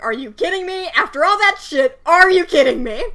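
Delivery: hammed-up screaming at both men; mock furious outburst. Woman screaming at the bandit and husband, mock furious. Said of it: "she's like".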